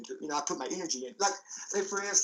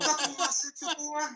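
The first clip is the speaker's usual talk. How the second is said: promo breaking into laughter; 10 semitones higher than usual. Acted.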